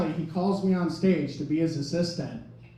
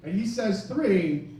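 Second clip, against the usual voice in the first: ordinary talk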